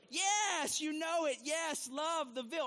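high, loud eager response; excited congregation reply. Retold or imitated. Playing the congregation answering back, loud and high. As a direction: answer back like a fired-up crowd. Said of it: "you would say".

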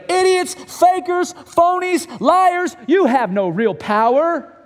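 loud, high, slow jeering insults; crowd shaming. Acted. Crowd publicly shaming the disciples, loud jeering. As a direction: hurl each insult at them.

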